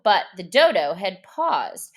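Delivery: usual speaking voice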